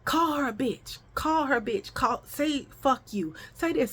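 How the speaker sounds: slightly high, softened mimicry of a woman; lightly voiced woman prompting children; retold grown lady egging on. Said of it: "I hear a grown lady".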